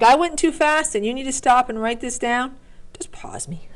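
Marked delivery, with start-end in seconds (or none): 3.0-3.7 soft unvoiced whisper; playful whispered aside. Real, not acted. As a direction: whisper it like a secret to the viewer.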